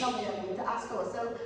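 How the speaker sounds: ordinary talk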